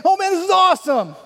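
very high, loud exclamation; overjoyed retold whoop; elated celebration. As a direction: burst out cheering in triumph.

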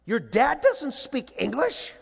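high, slow, incredulous question; deer-in-headlights bafflement. Retold or imitated. Poor coach asking the kids about their dad, imitated stunned disbelief. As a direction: stretch it out, totally dumbfounded. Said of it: "like a deer in the headlights".

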